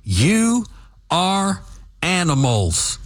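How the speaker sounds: You pitched high, then each word spaced apart; deliberate word-by-word condemnation; slow emphatic accusation. Real, not acted. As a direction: hammer out You, are, animals one word at a time. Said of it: "Listen to me clearly".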